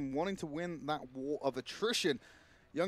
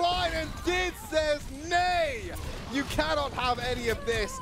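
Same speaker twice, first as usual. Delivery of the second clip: excited, higher-pitched esports cast; live play-by-play; 7 semitones higher than usual. Real, not acted. Caster calling a final fight to viewers, excited play-by-play. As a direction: call the fight with rising intensity.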